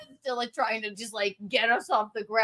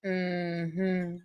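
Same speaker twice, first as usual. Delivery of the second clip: low, quiet, drawn-out hum; disapproving mm-hmm; 7 semitones lower, 6 dB quieter than usual. Retold or imitated. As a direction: judge her with one hum. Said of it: "And then he went".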